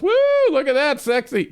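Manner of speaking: whooping, high mock catcall. Airmen catcalling him in his blues, high mock whoop. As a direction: jeer at him like a leering passerby. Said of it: "take all the cat calls from everybody else".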